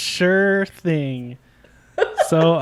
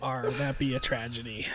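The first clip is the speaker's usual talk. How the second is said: pirate voice; 5 semitones lower, 12 dB quieter than usual. Acted. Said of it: "pirate voice".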